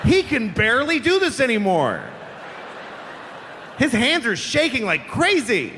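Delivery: high-pitched, hammed-up announcement; mock excited announcer. Flight attendant to passengers about the pilot's competence, mock-excited pitch. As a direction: deliver bad news as if thrilling.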